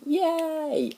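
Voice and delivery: high squeal of joy; childlike happy yay; excited cheer. Retold or imitated. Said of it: "I said".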